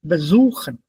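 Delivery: besuchen with SU leaned on; light BE, strong SU in besuchen; stress on second syllable. Shown as a demonstration. Teacher repeating correct besuchen, stress on SU. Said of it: "not separable".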